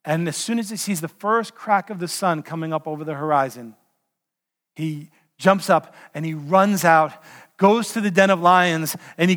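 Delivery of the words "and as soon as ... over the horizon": ordinary talk, nothing marked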